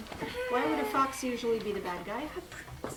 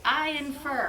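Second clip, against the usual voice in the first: I infer said clearly, louder, for repeating; model phrase; 3 semitones higher, 5 dB louder than usual. Shown as a demonstration. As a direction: say I infer for the class to echo.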